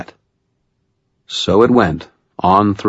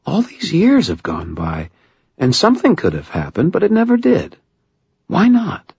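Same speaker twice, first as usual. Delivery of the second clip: higher woman's voice, rising with urgency; Murphy, earnest; 11 semitones higher than usual. Acted. Murphy confronting Harry about lost years, urgent rising voice. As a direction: lay it out to him, building to the question.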